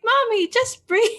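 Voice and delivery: put-on high little child's voice coaching mom; kid voice. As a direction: chirp it like a small son. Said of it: "They'd be like".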